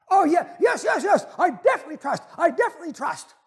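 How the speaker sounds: high, loud, fast gush of agreement; desperate, over-eager assurance; frantically eager tourist. Acted.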